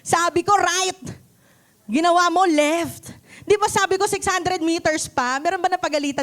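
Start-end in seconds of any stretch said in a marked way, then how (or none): 0.0-3.1 higher, louder mock scolding; angry navigation app scolding a driver who missed the turn; scolding shout. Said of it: "sinagawan kayo ni Waze". 3.4-5.4 mock scolding. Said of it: "sinagawan kayo ni Waze".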